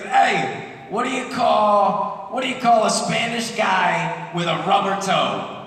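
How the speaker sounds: high, punchy Brooklyn delivery; Brooklyn boxing buddy. Acted. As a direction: tell it like a loud Brooklyn gym guy. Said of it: "he talks like this all the time".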